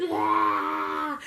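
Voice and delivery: long mock vomiting heave; retching noise. Storyteller acts out throwing up into sink, mock retching. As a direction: imitate puking into a sink.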